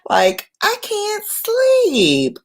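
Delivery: normal talking voice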